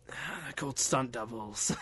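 slow, hushed gruff action star; slow, quiet mock tough guy; Steven Seagal. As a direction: drag it out softly in a gruff voice. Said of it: "in a really low, gruff voice".

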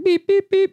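high mock electronic beeps; tracker beeping. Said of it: "it's like".

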